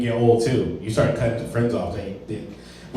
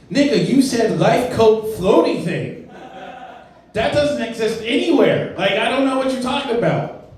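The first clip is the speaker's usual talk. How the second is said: incredulous mockery; 8 semitones higher than usual. Mocking friend's wrong answer.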